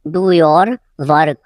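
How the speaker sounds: "do your", a pause, then "work"; slow it down, setting "work" apart; slow repeat. Shown as a demonstration. Teacher repeating for learners, slow and split.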